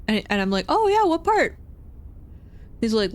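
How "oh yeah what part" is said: very high, over-bright small talk; put-on friendly curiosity; forced polite interest. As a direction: ask in an overdone chirpy pitch. Acted polite small talk reply.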